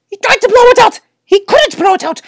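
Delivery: ordinary delivery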